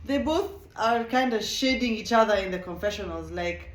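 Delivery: ordinary delivery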